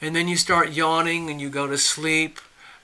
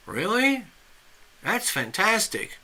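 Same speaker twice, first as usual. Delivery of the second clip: rising, higher-pitched "really", mildly amazed; lightly acted impressed stranger; impressed surprise; 6 semitones higher than usual. Stranger admiring the fisherman's catch, impressed surprise.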